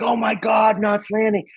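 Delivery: high-pitched excited squeal of a fan mom; gushing fangirl exclamation, retold; freaking-out mom. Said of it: "she was freaking out".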